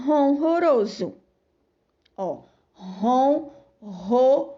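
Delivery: ronroso, slowly, each R clear; slow, stretched pronunciation. Shown as a demonstration. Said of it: "Observe a escrita e a pronúncia da palavra". (ronroso pronunciado devagar)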